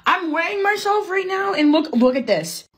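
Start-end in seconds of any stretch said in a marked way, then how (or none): none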